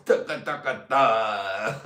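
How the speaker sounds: rhythmic mock chant; chanting a mock tune.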